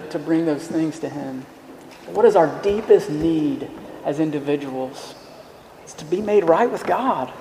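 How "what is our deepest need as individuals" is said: usual speaking voice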